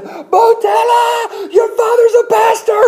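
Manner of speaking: high, loud celebrating cry; joyful shout. Retold or imitated. Nannies telling the baby his father is a pastor, joyful high shout. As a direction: nannies shouting the news in delight.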